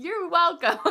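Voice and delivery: loud, high amused "you're welcome" through a laugh; doctor amused by a giddy patient; chuckling doctor. Retold or imitated. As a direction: answer with a big chuckle. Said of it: "gave a big chuckle".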